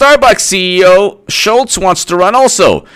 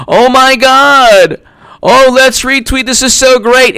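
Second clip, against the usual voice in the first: mocking gush; 7 semitones higher, slower than usual. Mocking gushing Twitter fans.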